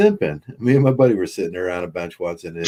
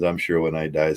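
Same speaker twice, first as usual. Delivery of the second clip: normal talking voice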